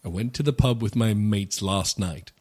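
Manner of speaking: imitated British accent. American copies the British guest's model sentence in British accent. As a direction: repeat it in a studied British accent. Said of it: "That was a good my accent".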